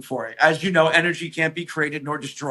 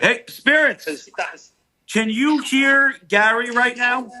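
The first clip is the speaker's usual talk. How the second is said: raised, slow call to the spirits; 7 semitones higher than usual. Real, not acted. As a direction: project it slowly into the empty room. Calling out to the spirits.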